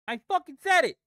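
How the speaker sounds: loud and high, furious at himself; angry shout. Real, not acted. Trader furious he called it and still lost, angry shout. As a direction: yell it at the screen.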